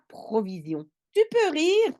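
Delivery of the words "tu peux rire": dad's line. Acted. Dad's line read in French.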